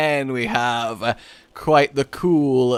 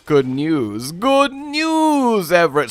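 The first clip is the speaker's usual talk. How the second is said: drawn-out, high swoop on "news"; first rough go at a character catchphrase; put-on character voice; 3 semitones higher, 3 dB louder, slower than usual. Shown as a demonstration. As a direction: stretch the announcement like a cartoon character. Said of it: "do a voice or an accent".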